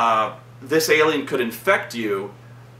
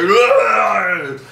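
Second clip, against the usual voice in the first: loud, high imitated snarling yell; feral roar; 8 semitones higher, 6 dB louder than usual. Infected man throwing a guy away, mock feral roar. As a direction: roar like something gone feral. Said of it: "his voice is all feral".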